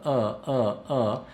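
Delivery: A said through near-closed teeth; A from the back of the mouth; vowel between the teeth. Shown as a demonstration. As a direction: hold the jaw still on the A. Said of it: "la digo así bien entre los dientes".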